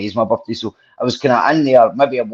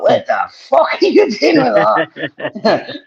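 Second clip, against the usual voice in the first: shocked gran; 9 semitones higher than usual. Retold or imitated. Gran catching him with rifle.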